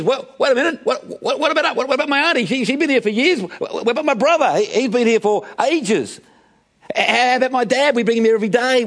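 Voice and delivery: high-pitched, stammering pleas tumbling out; protesting crowd. Acted. Overlooked people calling after Jesus as he slips away, high-pitched protest. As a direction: act out people indignant at being passed over. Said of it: "left a lot of people saying".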